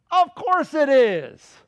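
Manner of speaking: loud, high, drawn-out answer; emphatic retort. Real, not acted. Preacher answering his own question about salvation, emphatic retort. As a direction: stretch it out and pitch it up forcefully.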